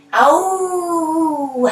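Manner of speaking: high playful howl; wolf howl. Shown as a demonstration. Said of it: "Howl like a wolf".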